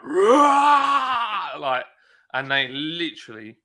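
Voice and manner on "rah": loud high roar, fully let loose; roaring at the men by the Jeep; roaring shout. Retold or imitated. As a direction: blast it out to scare them. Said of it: "I was like".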